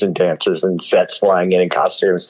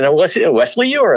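retold stage introduction; 8 semitones higher than usual. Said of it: "And he said".

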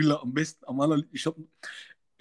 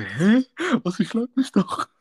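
much higher, laughing in disbelief; baffled laughing; 11 semitones higher, 5 dB louder, slower than usual. Retold or imitated. Warden baffled by the woman's taunt, laughing high. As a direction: laugh it off in total confusion.

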